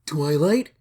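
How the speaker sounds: brother calling. Acted. The stranger at the door calls Twilight, sounding like her brother. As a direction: call her name like a brother.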